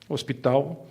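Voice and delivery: usual speaking voice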